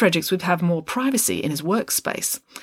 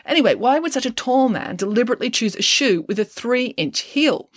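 higher, brisk explaining voice; clever girl detective; 8 semitones higher than usual. A narrator's reading. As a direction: lay out the clue like a sharp young sleuth. Friday reasons aloud.

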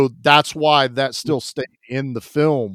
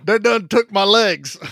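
Lieutenant Dan; 5 semitones higher than usual. Retold or imitated. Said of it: "You got Lieutenant Dan".

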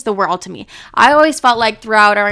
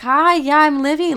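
higher, bright greeting to other parents; chirpy introduction; 6 semitones higher than usual. Retold or imitated. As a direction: play the friendly stand-in parent meeting people. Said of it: "I'm moving her into her dorm being like".

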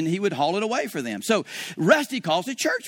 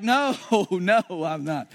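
laughing refusal; slower than usual. Retold or imitated. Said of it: "crawling off the altar like".

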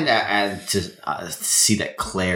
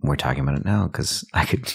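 usual speaking voice

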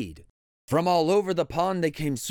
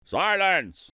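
mildly barked royal order; barked command; 3 semitones higher, 3 dB louder than usual. A narrator's reading. Yertle answers Mac's plea, barking. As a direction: cut him off with a kingly bark. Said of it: "barked back".

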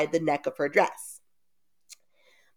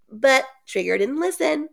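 ordinary talk

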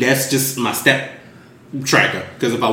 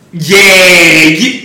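higher, loud phony enthusiasm; fake recognition; 4 semitones higher, 7 dB louder than usual. Running into someone at the grocery store, fake agreement. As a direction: draw out a phony friendly yeah. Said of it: "that's fake as shit".

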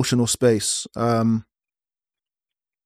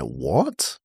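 low, quiet "what" rising at the end; quietly incredulous, lilting up; retold disbelief; 12 semitones lower, 6 dB quieter than usual. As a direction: act out a stunned, questioning reaction. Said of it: "you kinda sit there like".

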